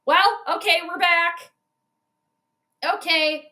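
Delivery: ordinary talk, nothing marked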